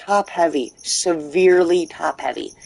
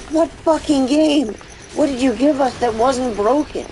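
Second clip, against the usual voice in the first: indignant outburst; 10 semitones higher, 3 dB louder than usual. Retold or imitated. Gamer yells back at the studio over the broken remaster, outraged. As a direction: shout it back at the developers in disbelief. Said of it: "And it's like".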